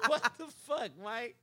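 high-pitched mock disbelief through laughter; laughing disbelief. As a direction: act out exasperation while cracking up. Retells team's disbelief while laughing.

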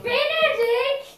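high, drawn-out name call. Real, not acted. Calling Benedikt out during the game, high drawn-out call. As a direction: sing out the name, stretched and high. Calls out to a suspected player.

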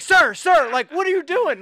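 loud, high, alarmed imitation of staff; flipping out. Hotel staff to a naked guest, flipping out. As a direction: call out as a scandalized hotel employee. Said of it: "They're flipping out like".

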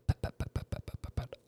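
whispered pat pat of heels fading off; heel clicks. Retold or imitated. Storyteller mimicking the doctor's heels on marble, whispered. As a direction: mimic footsteps walking away. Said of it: "heels on the marble floor".

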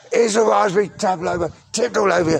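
high, put-on sing-out; high-voiced announcement. Sex line woman presenting the pavlova, high-voiced announcement.

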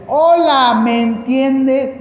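loud, high and very slow, over-articulated; condescending loud. Retold or imitated. Wrong way to greet a wheelchair user: loud, slow, condescending. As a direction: talk down as if he can't understand. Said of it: "no porque tengas silla de ruedas, no te entienden".

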